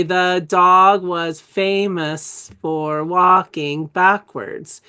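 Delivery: very slow, word-by-word model reading; reading aloud for followers. Shown as a demonstration. Teacher reads for them as they follow along, slow model reading. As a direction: stretch out each word so students can follow along. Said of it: "you can read it for them".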